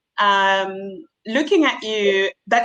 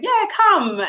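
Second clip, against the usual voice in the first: much higher, eager "come on over"; cheery phone welcome; 13 semitones higher, 3 dB louder than usual. Retold or imitated. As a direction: sound keen to hire the voice on the phone. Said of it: "people be like".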